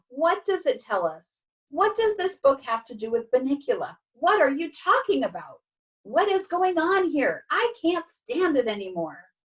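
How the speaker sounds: high, frantic string of questions; exasperated near scream. A narrator's reading. Dog who can't stand it anymore, exasperated. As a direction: rant at the end of your rope. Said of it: "I practically screamed".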